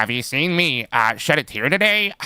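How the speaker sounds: lightly voiced whiny co-host; a hint of a whiny co-host; mocking his voice. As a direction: echo his words in a mild mock whine. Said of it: "I need to do his voice".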